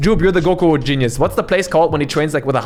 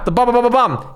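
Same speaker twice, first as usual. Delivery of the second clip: mock punch sounds; 8 semitones higher than usual. Streamer mimicking Ip Man fight punches, playful bam bams. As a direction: rattle off movie punch sounds.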